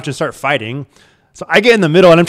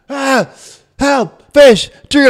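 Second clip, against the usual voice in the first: much higher, loud call for help; yelling for help; 12 semitones higher, 7 dB louder, much slower than usual. Retold or imitated. Breaking up the fight on the couch, high shouted call for help. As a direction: shout for backup while pulling them apart. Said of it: "yelling for somebody to come".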